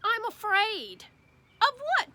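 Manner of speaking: put-on small scared boy's voice, slow; timid little kid; little boy afraid. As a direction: let the fear sit in a child's voice. Child and dad dialogue.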